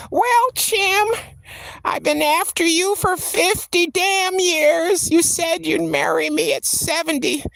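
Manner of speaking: put-on old lady scolding Tim for not marrying her; shaky old lady. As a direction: play herself at eighty-seven nagging Tim. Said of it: "I imagine my voice just being shaky".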